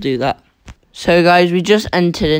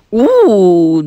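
excited ooh; 5 semitones higher, 7 dB louder than usual. Real, not acted. Admiring new sacred treasure.

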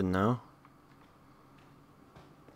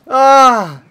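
terrified scream; 15 dB louder than usual. Real, not acted. Jump scare.

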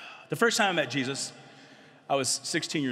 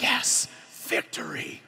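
whispered triumph; much slower than usual. Retold or imitated. Quiet triumph after making God laugh.

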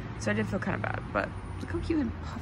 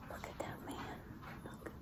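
whisper; 16 dB quieter than usual. Real, not acted. Whispered to puppy.

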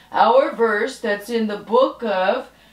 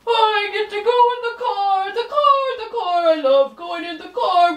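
higher eager cartoon dog voice; lightly higher voice of an excited pet dog; excited puppy puppet; 10 semitones higher, faster than usual. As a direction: bounce with puppy excitement about the car.

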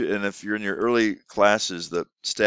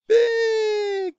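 very high and louder; big excited word; 4 dB louder than usual. Shown as a demonstration. Teacher modelling big for a child, high and excited. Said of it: "use full T P R".

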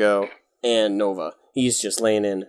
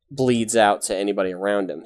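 ordinary delivery

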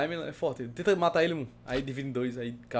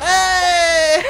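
sudden loud yell; shout; 13 semitones higher, 12 dB louder than usual. Real, not acted. Shout at the cow.

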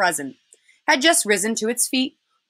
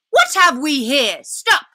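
higher, louder witch's command; imperious witch; 5 semitones higher, 5 dB louder, slower than usual. Acted. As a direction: call a sharp halt as the witch. Witch halts her sledge.

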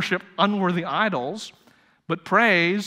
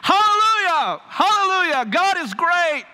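put-on high, loud, childlike shout of joy; boy hollering praise; 12 semitones higher, 5 dB louder than usual. A preacher voicing the boy shouting hallelujah on a bench. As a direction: holler it like an excited little boy. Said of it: "hooping and hollering in praise".

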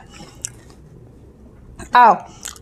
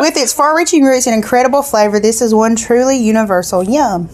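booklet read-aloud. Acted. Reads the snack booklet aloud.

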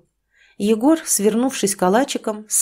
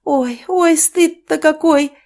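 high, loud, drawn-out lament of shame; mother scolding her son, high and loud; wailing scolding; 8 semitones higher, 5 dB louder, slower than usual. Acted. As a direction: wail at the shame of it. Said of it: "не переставала отчитывать мать".

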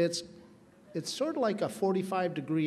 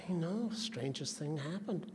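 whispering; 8 dB quieter than usual. Retold or imitated. Jack confides conspiratorially.

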